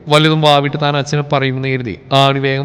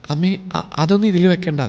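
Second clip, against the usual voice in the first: softer, hesitant, a touch of a tremble; trembling; 3 semitones higher, 4 dB quieter than usual. Acted. Bride stopping her mother-in-law, a mild tremble. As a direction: stammer the start, timid. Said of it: "വിറയലോടെയാണ് ആളത് പറഞ്ഞ്".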